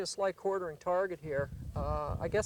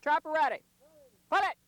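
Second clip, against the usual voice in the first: calling out; 10 semitones higher, 4 dB louder, faster than usual. Real, not acted. Calling to the trapper.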